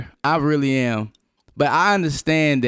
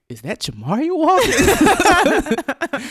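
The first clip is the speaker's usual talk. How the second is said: high, slow mock-shocked question; a guest hamming up disbelief; imitated guest; 13 semitones higher, 3 dB louder, much slower than usual. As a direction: play the startled visitor. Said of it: "they was like".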